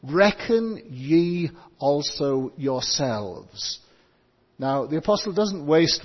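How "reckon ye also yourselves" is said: RECKON, YE, ALSO, YOURSELVES each slow and weighted; emphatic. Shown as a demonstration. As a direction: spell out "Reckon ye also yourselves" slowly, stressing each part. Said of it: "you notice the emphasis there".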